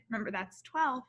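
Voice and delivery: ordinary delivery, nothing marked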